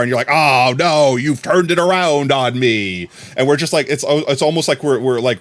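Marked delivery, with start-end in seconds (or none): 0.3-3.1 slow, theatrical mock dismay; hammed-up dismay. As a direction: ham it up like a beaten wrestler playing to cameras. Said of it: "I'm letting you ham it up".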